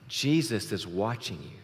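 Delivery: a hint of an eerie voice, pitched low; lightly voiced mystery warning, low; mysterious voice. Joke teller repeating the unseen voice's warning, mildly mysterious. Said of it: "He hears it again".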